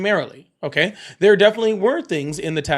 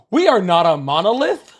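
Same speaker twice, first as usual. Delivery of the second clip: mock conservative speaker; 7 semitones higher than usual. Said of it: "That's all I could think of".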